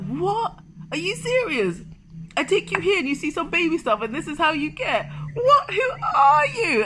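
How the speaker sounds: high, loud, incredulous yelling; mock-shocked boyfriend; baffled outburst. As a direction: shout in disbelief at her. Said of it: "He was like".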